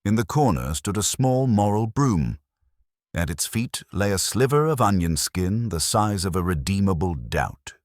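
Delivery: ordinary talk, nothing marked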